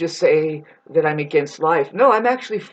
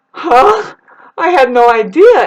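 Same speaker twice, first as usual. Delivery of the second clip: mock surprise; 6 semitones higher, 9 dB louder than usual. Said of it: "Some men are like".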